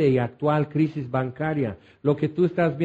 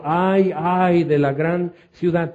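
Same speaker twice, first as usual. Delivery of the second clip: lamento leído; 3 dB louder, slower than usual. A narrator's reading. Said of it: "dieron voces llorando y lamentando".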